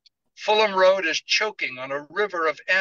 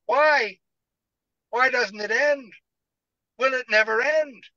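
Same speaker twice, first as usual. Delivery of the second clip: much higher and louder, crying out; anguished cry through tears; sobbing outcry; 10 semitones higher, 4 dB louder than usual. Acted. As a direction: sob the questions to the sky. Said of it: "bursting into hot, bitter tears".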